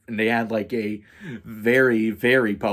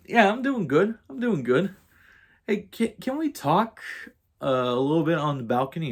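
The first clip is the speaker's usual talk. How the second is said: high-pitched, tentative retold request; awkward small talk; 7 semitones higher than usual. Asking roommate for a talk on the balcony, awkward high voice. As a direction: act out uneasy politeness in a raised voice.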